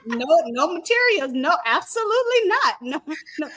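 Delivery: ordinary talk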